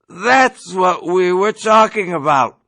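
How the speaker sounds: cried out. Acted. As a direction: exclaim it in triumph. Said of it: "Yossarian cried".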